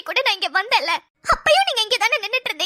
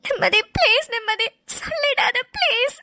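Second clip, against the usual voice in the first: tearful begging. Acted. Begs niece not to tell.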